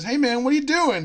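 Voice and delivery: high, loud startled challenge; alarmed bartender. Retold or imitated. Bartender to man swinging his dog, loud alarmed protest. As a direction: call out in acted disbelief.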